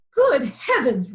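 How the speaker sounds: loud exasperated roar. A narrator's reading. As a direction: bellow it in dismay. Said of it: "roared Lion".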